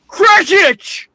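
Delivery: very high, loud shout of the name; angry yell. Acted. Scrooge catching his clerk giving money, angry yell. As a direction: bellow the clerk's name in annoyance.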